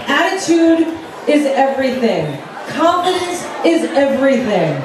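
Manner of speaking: ordinary talk, nothing marked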